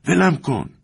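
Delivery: high-pitched cry to be released; struggling protest. A narrator's reading. One of two men grappling, crying out in high protest. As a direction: call out in protest, pitch leaping up.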